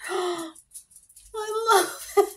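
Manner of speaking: gasping, high and delighted; excited gasp. Real, not acted. Opening a gift from her friend, real delighted squeal.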